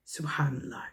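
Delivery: breathy hushed whisper, falling away; whispered in awe; whispered subhanallah. Real, not acted. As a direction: drop to a reverent whisper. Awed aside after a moral point.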